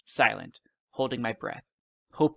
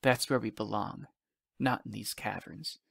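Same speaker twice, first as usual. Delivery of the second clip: whisper; 6 dB quieter than usual. A narrator's reading. Father telling his child where they belong, hushed. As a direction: quietly confide it, voice dropping away. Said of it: "he whispered".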